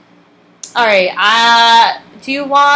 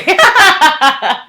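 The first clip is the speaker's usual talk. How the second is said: big burst of laughter; loud guffaw; 8 semitones higher, 6 dB louder than usual. Real, not acted. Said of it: "Sorry about that guffaw".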